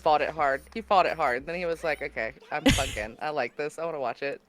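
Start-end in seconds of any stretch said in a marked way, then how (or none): 2.0-4.4 low, soft concession; reluctant admission. Retold or imitated. Husband who fought it hard admits defeat, low and sheepish. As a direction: give in quietly, pitch dropped.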